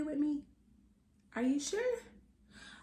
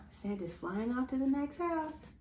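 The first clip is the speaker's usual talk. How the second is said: ordinary delivery, nothing marked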